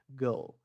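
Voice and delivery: put-on GIRL with no R, light L; British, silent R. Shown as a demonstration. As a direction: say GIRL swallowing the R. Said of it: "Eu como esse R". (GIRL sem R, à britânica)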